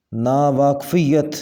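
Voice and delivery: ناواقفیت ending on "fiyat": naa-waaq-fi-yat; correct form. Shown as a demonstration. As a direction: sound the full "-yat" of ناواقفیت. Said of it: "صحیح تلفظ ہوگا". (ناواقفیت کا صحیح تلفظ، آخر میں "یت")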